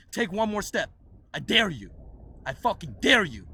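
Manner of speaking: retold angry standoff; menacing dare. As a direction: dare them to take a step.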